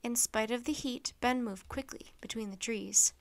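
usual speaking voice

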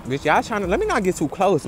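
normal talking voice

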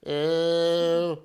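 slow, drawn-out worried owl voice; slow middle brother owl. Acted. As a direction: stretch out goodness, fretting. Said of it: "said Percy".

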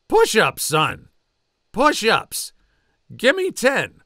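loud drill sergeant command; drill sergeant order. Acted. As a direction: spell it out for the clueless private.

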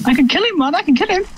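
high squeaky boast. Real, not acted. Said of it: "Don't do a squeaky voice".

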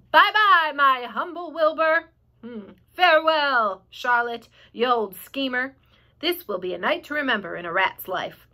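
hammed-up greedy rat; gloating rat. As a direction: revel in the feast ahead.

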